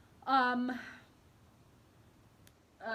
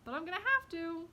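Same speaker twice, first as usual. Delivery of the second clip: put-on higher, louder whiny toddler protest; toddler tantrum; 14 semitones higher, 5 dB louder than usual. Writer's inner toddler giving in to the rewrite, high whiny wail. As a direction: whine it like a kicking toddler. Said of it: "kicking, screaming, like".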